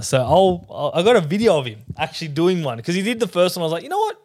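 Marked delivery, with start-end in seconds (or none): 3.8-4.2 high retold musing. Retold reaction to the first joke.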